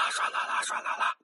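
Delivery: breathy hushed rushing-water sound; whispered water sound. Acted. Storyteller voicing the thawed river flowing under the bridge, whispered.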